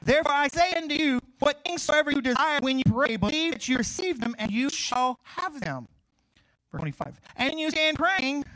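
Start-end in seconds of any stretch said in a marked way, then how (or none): 0.0-5.9 loud, high preacher's reading of scripture, slowing at the end. Acted. 7.4-8.4 loud, high preacher's reading of scripture, slowing at the end. Acted.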